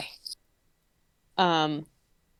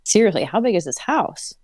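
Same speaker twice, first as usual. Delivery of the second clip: story narration; 4 semitones lower than usual. Acted. Reads the story aloud.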